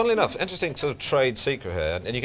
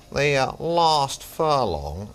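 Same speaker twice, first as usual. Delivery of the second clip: slow, raised commentator voice; Norris McWhirter; 4 semitones higher, 4 dB louder, much slower than usual. Retold or imitated. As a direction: imitate a commentator, drawing out every word. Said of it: "sounds a bit like Norris McWhirter".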